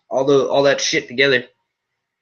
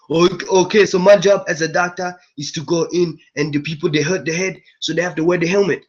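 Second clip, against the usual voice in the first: mocking a generic African accent, a bit higher; hammed-up imitation of a fake African accent; bad generic African accent; 4 semitones higher than usual. Mocking Will Smith's Concussion doctor in a generic African accent. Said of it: "He sounded like the African dude from fucking Captain Planet".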